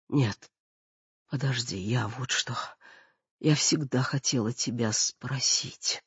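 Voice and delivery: frail dying mother. Acted.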